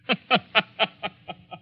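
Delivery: gloating villain laugh. Acted. Professor laughs at Herman before leaving him. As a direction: laugh as if the trap is already sprung.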